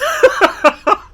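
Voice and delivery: big high laugh; loud burst of laughter. Real, not acted. Laughs at his own story.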